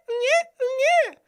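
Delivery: very high, loud newborn wail; baby cry. Pretend. Augustus recalls Drusus being born, high newborn wail.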